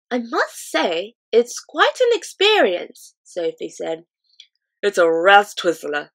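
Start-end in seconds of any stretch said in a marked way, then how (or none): 0.1-3.1 put-on much higher, slow, amazed girl; girl's voice. A narrator's reading. Sophie after the drink, amazed girl voice. 4.8-6.1 louder, hearty friendly giant; jolly giant voice. A narrator's reading. Giant praising his fizzy drink, hearty giant voice.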